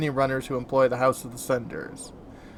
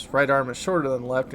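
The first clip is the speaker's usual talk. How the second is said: usual speaking voice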